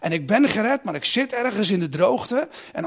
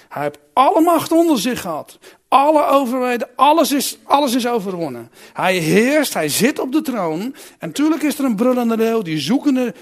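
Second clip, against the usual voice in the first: louder, ringing proclamation of victory; fervent preaching; 3 semitones higher, 5 dB louder than usual. Acted. Preacher to church about Christ on the throne, triumphant preaching.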